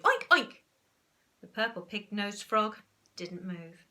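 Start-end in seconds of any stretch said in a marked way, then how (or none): 0.0-0.6 put-on high, loud pig oinks from a boy; boy oinking. Boy oinks through the glass at the frog. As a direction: oink eagerly at the frog. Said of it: "went the boy".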